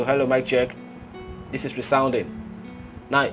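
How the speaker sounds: usual speaking voice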